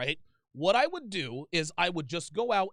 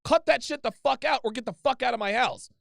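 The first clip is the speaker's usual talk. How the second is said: put-on loud, higher, angry order at a girlfriend; raised voice; 5 semitones higher, 6 dB louder than usual. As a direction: bark it out like you mean it. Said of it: "you need to raise your fucking voice".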